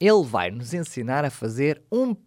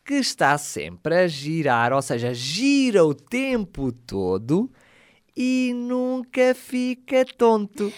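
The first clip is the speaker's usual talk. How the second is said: slow riddle reading; slower than usual. Acted. Riddle repeated slowly for kids.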